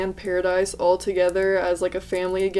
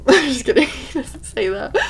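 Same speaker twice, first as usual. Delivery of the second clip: laughing; 5 semitones higher, 3 dB louder than usual. Real, not acted. Laughs at own joke.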